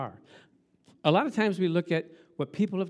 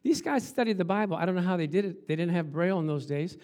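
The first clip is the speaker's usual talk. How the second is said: ordinary talk, nothing marked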